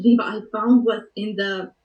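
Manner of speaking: normal talking voice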